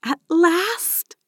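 high-pitched delighted relief; elated thought. A narrator's reading. Charlotte thinks it while prancing upstairs, elated. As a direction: let it out as a happy, high exhale. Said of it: "Charlotte thought as she pranced up the stairs".

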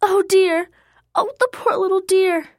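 put-on high, fluttery, fussing old lady; a flustered lady clucking over the poor animal; fussy lady caller. A narrator's reading. Caller to Keisha about the deer, high fussy lady voice.

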